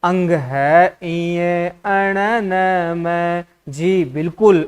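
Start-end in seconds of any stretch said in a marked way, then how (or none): 0.0-3.4 अंग है इये अण न म, each nasal letter slowly drawn out; correct pronunciation demo. Shown as a demonstration. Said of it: "अगर आप ऐसा उच्चारण कर पा रहे हैं". (अंग है इये अण न म का धीमा, खींचा हुआ शुद्ध उच्चारण)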